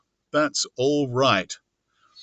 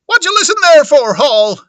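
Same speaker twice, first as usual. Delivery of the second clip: high, loud scolding wife; shrieking reprimand to a husband; Missus Hall; 13 semitones higher, 11 dB louder than usual. Acted. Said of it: "Missus Hall".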